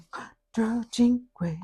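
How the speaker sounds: whispered. Acted. Whispered count.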